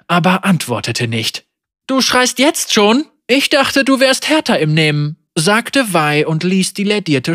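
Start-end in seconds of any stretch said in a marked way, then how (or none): 1.9-5.2 higher mocking taunt. A narrator's reading. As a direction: sneer at his weakness. Wei mocks him.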